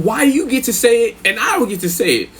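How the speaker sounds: high-pitched mimicked protest; aggrieved cellmate. Cellmate asking why he can't say it, high protest. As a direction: complain high, as if wronged. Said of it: "tripping, like".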